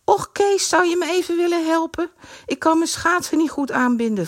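put-on high pleading girl's voice; girlish voice. A narrator's reading. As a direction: sweetly ask him for help. Girl asking for help.

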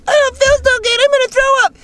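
put-on very high little-kid voice about to be sick; sick school kid. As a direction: squeak it like a queasy child. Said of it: "a kid at school getting sick".

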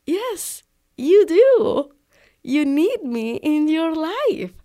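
high, loud, teasing insistence; playful insistence. Retold or imitated. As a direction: push back at him, loud and high, slow at the end.

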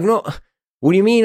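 shocked disbelief. Retold or imitated. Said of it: "And I'm like".

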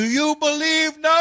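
high triumphant announcer-style yell; mock victory shout. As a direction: shout it out to the crowd like a famous call. Imagined triumphant field celebration.

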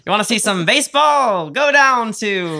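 loud, high vintage radio pitch; old-timey announcer. Pretend. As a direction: hawk it like a 1940s radio host. Continuing the announcer bit.

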